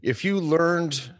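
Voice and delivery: loud, emphatic pushback. Real, not acted. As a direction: cut in loud and insistent. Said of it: "I'm gonna interject though".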